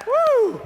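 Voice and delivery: high, loud woo; celebratory crowd whoop; exhilarated whoop. Real, not acted. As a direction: let out a big whoop. Whoops after the mass scream.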